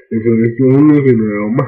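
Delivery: very loud, booming announcement; sudden booming. A narrator's reading. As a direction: blast it out absurdly loud. Sudden booming end to narration.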